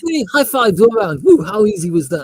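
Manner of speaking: whooping soldier, high and loud; cocky grunt cheering the squad; loud gung-ho soldier. Acted. Cocky soldier celebrating survival.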